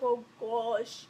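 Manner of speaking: low, soft aside of dread; dread under breath. Retold or imitated. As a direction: let it sink, quiet and low. Dread when teacher calls her over.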